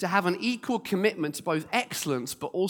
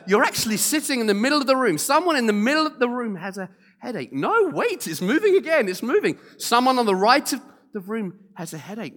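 higher and louder, urgent and rushing; frantic prophecy; 6 semitones higher, 4 dB louder than usual. Retold or imitated. Lady on stage excitedly redirecting her word to the crowd, frantic. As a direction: chase the headache around the room excitedly. Woman frantically relocating the headache.

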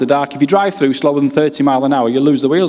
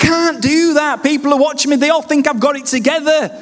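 retold protest; 10 semitones higher than usual. His own retold protest.

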